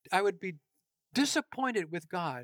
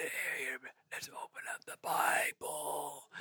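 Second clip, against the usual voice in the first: soft voiceless rasp, like a lost voice; raspy whisper; 6 semitones lower, 8 dB quieter than usual. Retold or imitated. Sick pastor opening the sermon to leaning congregation, raspy whisper. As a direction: croak it out with no voice left. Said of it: "I was so raspy".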